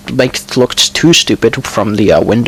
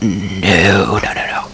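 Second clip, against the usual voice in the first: whispered no no no, annoyed at the mistake; under the breath, giving up on the fix; frustrated whisper; 5 semitones lower, 5 dB quieter, much slower than usual. Real, not acted. Frustrated at a crooked pile.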